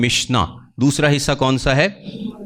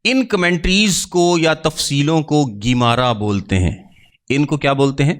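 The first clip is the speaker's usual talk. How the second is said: usual speaking voice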